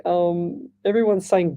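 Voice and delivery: ordinary talk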